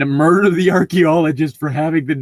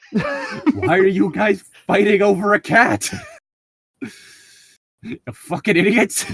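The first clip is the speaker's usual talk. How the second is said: mock the idiots through a laugh; Ruru scoffing; 8 semitones higher than usual. Voicing Ruru scorning the party.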